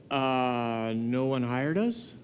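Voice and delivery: low, slow mumbled answer rising into a question; hesitant, dragged-out excuse; sheepish. Acted. Idle workers answering the landowner, sheepish.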